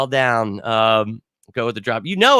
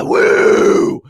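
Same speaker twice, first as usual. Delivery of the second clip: excited woo; 7 dB louder than usual. Real, not acted. Hyped after meatballs.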